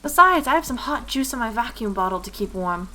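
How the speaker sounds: put-on keen, polite little girl; dutiful little shopgirl. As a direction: reassure an adult as a child helper.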